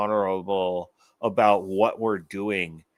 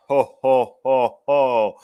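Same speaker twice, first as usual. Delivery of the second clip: louder, jolly mock Santa laugh; hammed-up Santa ho ho ho; Santa Claus; 7 dB louder, slower than usual. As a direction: boom it out like Santa. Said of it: "he's Santa Claus this year".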